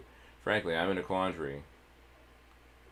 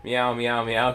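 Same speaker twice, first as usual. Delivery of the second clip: higher, louder mock meowing; cat meows; 4 semitones higher, 6 dB louder, much slower than usual. Kid fakes cat noises to fool the goblins, mock meows. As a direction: meow like a stray cat. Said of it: "got the voice a second time".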